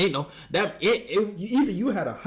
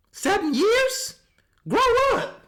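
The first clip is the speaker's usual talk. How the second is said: much higher, slow, louder disbelief; incredulous outburst; 5 dB louder, slower than usual. Real, not acted. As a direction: shriek in disbelief at him. Disbelief at the storyteller.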